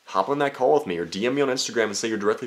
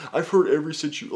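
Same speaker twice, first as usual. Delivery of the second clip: mock asker; 8 semitones higher than usual. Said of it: "where you're just like".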